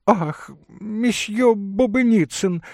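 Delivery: high, slow, delighted greeting; chirping lady. Acted. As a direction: twitter sweetly at an acquaintance. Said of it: "защебетала дама". (щебечущий высокий дамский голос)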